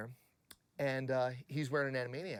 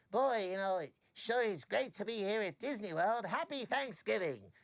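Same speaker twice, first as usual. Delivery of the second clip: a light cartoon kid voice, higher and slow; Wakko voice; 7 semitones higher, slower than usual. Shown as a demonstration. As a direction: show off the cartoon voice for the doubting boy. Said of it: "let me hear you do it".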